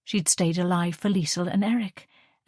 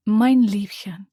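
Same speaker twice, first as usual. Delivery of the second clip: slow, louder German endearment; tender mother; 3 semitones higher, 6 dB louder, slower than usual. Acted. Mother caressing her daughter's hand, tender. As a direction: linger over it lovingly.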